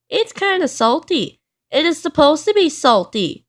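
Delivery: hammed-up stubborn shout at his friend. A narrator's reading. Mister Stubborn insists the salty batter is fine, shouting. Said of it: "shouted Mister Stubborn".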